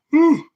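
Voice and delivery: loud high yawning exhale; big yawn. Real, not acted. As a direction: let out a long loud yawn. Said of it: "Sorry, everybody. Had to be done".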